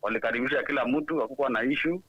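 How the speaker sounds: ordinary talk, nothing marked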